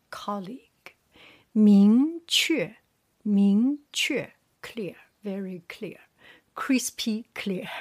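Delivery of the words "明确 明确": 明确 with a long emphatic rise on 明, falling 确; second tone, fourth tone. Shown as a demonstration. Teacher drilling for learners, 明 rising, 确 falling. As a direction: lift 明, then drop 确. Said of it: "second tone with the fourth tone".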